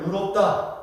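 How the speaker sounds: "의롭다" said as eu-rop-da, Jeolla style; Jeolla pronunciation. Shown as a demonstration. Preacher shows Jeolla speakers saying righteous, Jeolla eu for ui. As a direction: Jeolla accent, flattening 의 to eu in "의롭다". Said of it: "의 발음이 잘 안 돼요".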